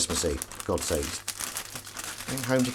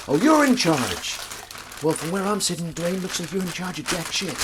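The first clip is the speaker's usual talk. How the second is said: sarcastic hero; 8 semitones higher, 4 dB louder than usual. Retold or imitated. The hero mocking the police chief, sarcastic. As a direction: sneer it back. Die Hard hero retort.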